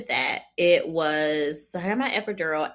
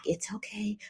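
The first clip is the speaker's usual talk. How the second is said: soothing doula; 4 semitones higher, 11 dB quieter than usual. Retold or imitated.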